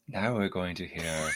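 lightly put-on, soft and measured like a radio announcer; mock NPR mode. Shown as a demonstration. Host joking about book club, soft mock radio voice. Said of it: "like, N P R mode".

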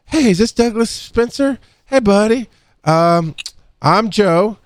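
high, chipper hired-messenger voice; cheery bad-news messenger. Bad-news man greeting the husband, high and chipper. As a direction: put on a bright stranger's greeting, pitched high. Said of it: "they'll do it and like".

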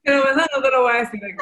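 loud, fast, high words through laughter; laughing joke. Real, not acted. Guest joking back to host, laughing hard. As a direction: crack up while saying it.

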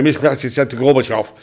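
fast and gabbled, "Missus Thatcher said to Gorbachev" thrown away; rushed delivery. Shown as a demonstration. Comic showing the wrong way to deliver a line, rushed and mumbled. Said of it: "Don't go on and say".

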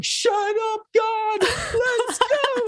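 high, thrilled cry of disbelief; squealing shout of amazement; excited outburst. Retold or imitated. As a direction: blurt it out, overjoyed. Excited reunion exclamation.

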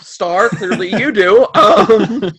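laughing loudly through the tease. Real, not acted. Game master teasing Star over the book, laughing out loud. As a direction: crack up while you needle her.

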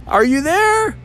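very high calling question; boy calling out. Retold or imitated. Boy calls into the cave, high call. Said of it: "And the boy started yelling".

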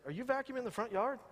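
a hint of a shy son, asking in disbelief; son's soft voice. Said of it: "he talks real soft".

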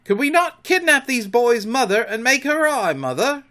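high, loud, eager pitch. A narrator's reading. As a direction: propose it with keen excitement. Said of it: "Smee's eager voice".

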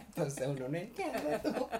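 usual speaking voice

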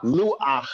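Luach ending in a throaty ach, vowel before ch; final ach in luach. Shown as a demonstration. Teacher correcting a student's luach. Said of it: "It's not lucha".

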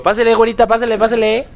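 high, loud vendor's call; hyped fairground barker. Pretend. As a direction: call out to passing shoppers. Imitates market vendor calling out.

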